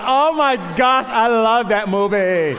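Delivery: high, loud, drawn-out gush; gushing fan girl. Retold or imitated. Pastor imitating the ladies gushing over the chick flick, mock excited. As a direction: swoon over the movie like a superfan. Voicing the ladies who love the movie.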